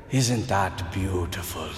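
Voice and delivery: deep, slow Irish priest's marvel with a laugh; Irish accent. Retold or imitated. Old Irish priest stopping his homily, low slow Irish accent. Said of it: "he still had the Irish accent".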